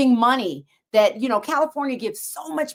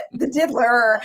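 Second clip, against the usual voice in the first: laughing; 3 semitones higher, slower than usual. Real, not acted. Repeating punchline laughing.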